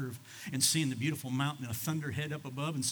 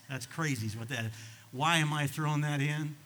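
ordinary talk

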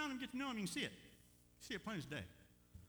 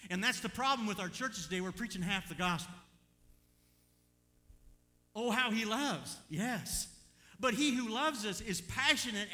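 loud, raised, driving preacher delivery; full-force preaching; 7 semitones higher, 9 dB louder than usual. Real, not acted. Preacher telling church he's not down on us, full-force preaching. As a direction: preach it hard to the congregation.

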